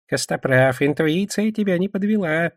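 hushed goblin voice climbing in pitch; awed goblin. Acted. Goblin praises Костоправ's intuition, awed. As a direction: let the awe rise through the line. Гоблин продолжает благоговейным тоном.

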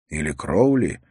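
plain reading voice

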